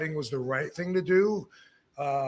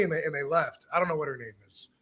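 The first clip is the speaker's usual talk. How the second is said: ordinary delivery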